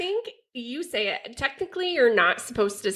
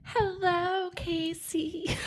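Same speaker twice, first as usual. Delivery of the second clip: sexy voice; 6 semitones higher, 7 dB quieter, much slower than usual. Acted. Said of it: "she's getting her sexy voice on".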